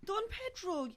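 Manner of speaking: put-on Irish accent. Wife finding Don Pedro stabbed, hammy Irish accent. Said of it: "required to do an Irish accent".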